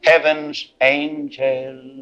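sing-song sermon peak, soaring high; whooping preacher. Real, not acted. Closing whoop over music.

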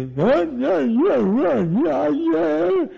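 high, wailing, inarticulate cries; Urban Bell. Retold or imitated. Afflicted choir member urging the drunkard, high strained imitated cries. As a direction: imitate a man straining to speak, high and urgent. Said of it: "he just couldn't talk right".

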